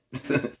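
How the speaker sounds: short quiet chuckle at his own stumble; soft chuckle. Real, not acted. Reader laughing at losing his place, soft chuckle.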